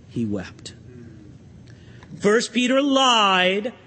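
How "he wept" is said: low, soft and grave; a quiet, heavy close; hushed solemn reading. Real, not acted. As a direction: let the voice drop right down. Solemn close of Peter's denial reading.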